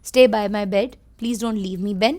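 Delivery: tender plea. Acted. Sick Tom begging his dog Ben to stay, soft and tender. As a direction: gently beg him to stay close. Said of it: "बड़े प्यार से बोला".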